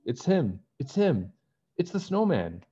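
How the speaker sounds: rising alarm, pointing him out. Acted. Bess to her friends, pointing out the snowman in rising alarm.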